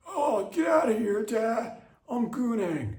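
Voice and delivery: put-on high, loud bratty teen yelling at dad; shrill-pitched brat shooing his father away; whiny teen son.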